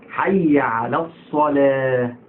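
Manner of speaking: slow chant with الصلاة ending in a thin "leh"; light lam. Shown as a demonstration. Teacher correcting the muezzins, chanted light lam. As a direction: stretch حي, then a light-l الصلاة. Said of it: "رقيقة".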